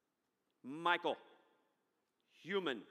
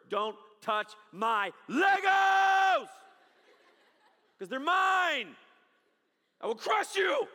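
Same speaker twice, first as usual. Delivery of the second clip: put-on slow high roaring toddler, much higher and louder; furious toddler; 10 semitones higher, 9 dB louder, slower than usual. Preacher voicing his son guarding his Legos, furious toddler yell. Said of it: "alarm system".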